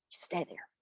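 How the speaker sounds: whispered command to stay hidden; very soft, secretive warning; hushed order. Acted. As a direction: whisper it under your breath. Sisters hiding Ashpet under washtub.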